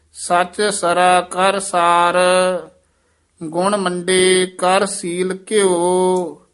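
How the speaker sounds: measured chanting recitation of Gurbani; scripture recitation. Shown as a demonstration. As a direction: recite each phrase slowly and evenly.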